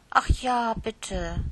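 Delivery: hoch und laut bettelndes „Och“; drängelnd. Acted. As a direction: quengelnd um etwas bitten. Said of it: "drängelte Lieschen weiter". (Lieschen bettelt die Tante an)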